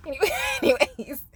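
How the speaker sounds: laughing reset. Real, not acted.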